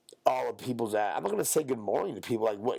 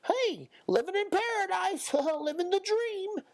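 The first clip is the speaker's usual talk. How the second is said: high, sing-song mock greeting with laughs; mocking cheery coworkers. Mocking coworkers' chirpy greetings, high and laughing. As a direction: parody the chirpy old-folks-home staff. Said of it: "everybody's like".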